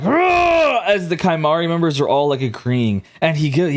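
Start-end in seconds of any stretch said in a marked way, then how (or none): 0.0-0.8 game master voicing a cheering tribe with a high rah; yelling hard. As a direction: bark it out like a warrior. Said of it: "They're, like, yelling hard as fuck".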